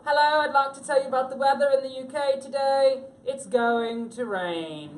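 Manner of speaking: hammed-up British accent, like a weatherman on TV; mock British weatherman. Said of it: "I'm sorry for the accent".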